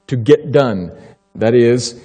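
usual speaking voice

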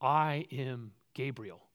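slow, plain and simple; quiet, unhurried self-introduction; said simply. Acted. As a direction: state it calmly and slowly. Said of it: "The angel says simply".

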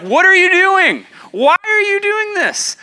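shouted high and loud, challenging an intruder; alarmed shout. Retold or imitated. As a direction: yell it like a startled church leader stopping someone. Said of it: "somebody hopefully would stop you and would say".

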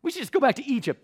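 high, loud mock whine; self-righteous whining. Thirsty Israelites blaming Moses, self-righteous mock whining. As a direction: complain like an indignant kid, hammed-up. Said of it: "the whining. It's the unbelievable sense of, like, self-righteousness".

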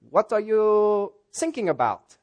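put-on slow, high German-accented question; puzzled German trainee, drawn out. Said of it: "new trainee for the German Coast Guard".

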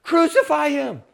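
high, loud, sustained shout; full-force imitated crowd shout; crowd yell. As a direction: yell it as the mob demanding a verdict. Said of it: "And the crowd yells".

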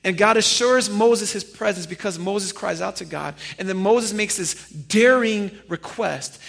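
normal talking voice

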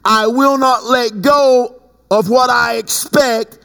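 high, stretched-out proclamation; fervent preaching. Real, not acted. Preacher declaring the word God gave, fervent preaching. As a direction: proclaim it high, drawing the phrases out.

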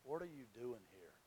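very soft, low closing question; quiet, low, searching question; hushed question. Retold or imitated. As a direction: let it drop almost to nothing. World's question, ending the sermon.

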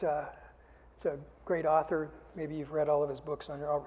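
ordinary talk, nothing marked

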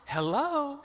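high, sugary phone greeting; sweet Southern belle. Retold or imitated. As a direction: switch instantly to syrupy charm. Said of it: "she sounds like a sweet Southern belle".

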